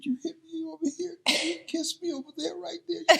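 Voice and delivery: put-on high tearful child voice; small child voice. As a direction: ask like a hurt little boy wanting a kiss. Child voice asking mother for kiss.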